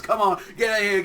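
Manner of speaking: pushy saleswoman. Retold or imitated. Girl urging the group inside, loud barker call. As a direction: wave them in like a barker. Witch girl hawking invisibility cloaks.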